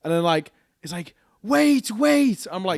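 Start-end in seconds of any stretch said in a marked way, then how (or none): 1.4-2.5 put-on high pleading call of a drunk woman; calling lady. Mimicking the drunk lady calling after them, high pleading voice.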